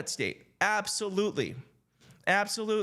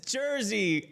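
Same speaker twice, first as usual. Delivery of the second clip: ordinary talk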